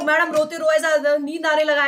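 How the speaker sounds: lightly mocking a drowsy pupil; sleepy student. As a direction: play the sleepy student, half teasing. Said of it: "ऐसा तो नहीं हो रहा ना?". (नींद में छात्र की हल्की नकल)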